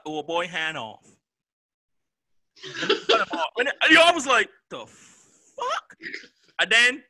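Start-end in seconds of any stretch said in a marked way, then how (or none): 4.7-6.0 much higher, laughing disbelief; stunned, laughing outburst; shocked laugh. Retold or imitated. Shock at gore scene.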